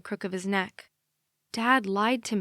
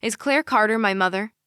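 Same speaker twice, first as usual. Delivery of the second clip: louder, blurted question; 6 dB louder than usual. Acted. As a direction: blurt it out too loud. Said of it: "I blurt much louder than I intended to".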